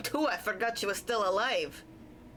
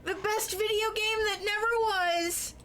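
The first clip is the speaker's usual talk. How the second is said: excited gush; 12 semitones higher than usual. Real, not acted. Gushing about a favourite game.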